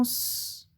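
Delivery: long sustained hiss for "S"; pure held sss on "S"; isolated S sound. Shown as a demonstration. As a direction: say "S" as a drawn-out sss, not the letter name. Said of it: "tu entends le son S".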